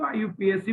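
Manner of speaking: "आईयूपीएसी" as five letter names; IUPAC letter by letter. Shown as a demonstration. Teacher warning students off the spelled-out reading, letter by letter. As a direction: read "आईयूपीएसी" out as initials. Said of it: "मत पढ़िएगा आज के बाद".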